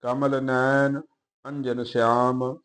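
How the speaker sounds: slow, measured Gurbani reading; even, deliberate verse reading; scripture recitation. Acted. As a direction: recite line by line with drawn-out vowels.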